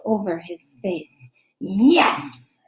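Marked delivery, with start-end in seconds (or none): 1.6-2.6 whispered cheer; a secret whispered triumph; whispered yes. A narrator's reading. Boy whispers a cheer at his rival's shock.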